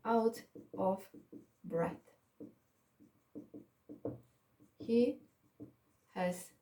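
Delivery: very slow, a long pause after each word; word-by-word dictation. Shown as a demonstration. Teacher writing the sentence for the student, dictating word by word. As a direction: dictate it one word at a time while writing. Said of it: "I will write the sentence for you".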